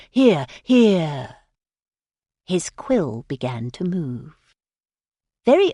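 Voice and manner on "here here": loud, urgent spirit-writer call; a hammed-up medium's cry; sudden loud cry. A narrator's reading. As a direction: blurt it out as if seized by a vision. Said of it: "said Mister Smith suddenly".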